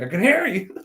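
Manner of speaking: high-pitched imitated yelling; retold indignant holler; mock yell. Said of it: "literally yells at them".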